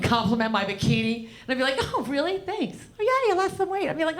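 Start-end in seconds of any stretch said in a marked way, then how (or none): 1.9-3.9 high, sugary fake-thankful voice; mocking workmate reply. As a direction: gush back in a put-on high voice. Mock fake-gracious reply to workmate.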